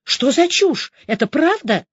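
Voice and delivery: high-pitched incredulous questions; agitated. A narrator's reading. Woman confronting the major about the news, agitated. Rushing up, demanding the truth.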